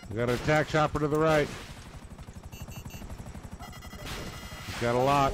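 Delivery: sudden alarm at an enemy chopper; urgent callout. Real, not acted. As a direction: warn the team in a rush. Spotting enemy attack chopper.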